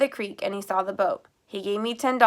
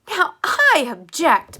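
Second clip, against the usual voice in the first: much higher, louder and slow; indignant cry; 7 semitones higher, 10 dB louder, slower than usual. A narrator's reading. Woman protests the sheriff cuffing Dale, loud indignant cry. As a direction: protest loudly as he cuffs the boy. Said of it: "Miss Lana cried".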